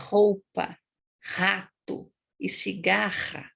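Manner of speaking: throaty R in "Roupa", "rato", "cigarra"; guttural h-like R in all three words; same strong R. Shown as a demonstration. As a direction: space out "Roupa, rato e cigarra", rasping each R. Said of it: "o som é o mesmo".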